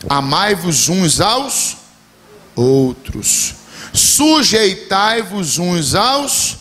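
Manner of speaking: drawn-out, weighty quoting of scripture; slow emphatic scripture. Acted. Preacher slowly quoting scripture to the church. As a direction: stretch each word like a command.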